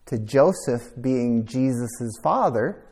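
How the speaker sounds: ordinary talk, nothing marked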